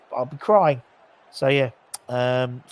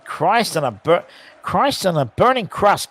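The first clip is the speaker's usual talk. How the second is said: exasperated outburst, pitched up; exasperated exclamation; 6 semitones higher, 5 dB louder than usual. Real, not acted. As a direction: groan it out in disbelief. Exasperated exclamation at a misplaced pass.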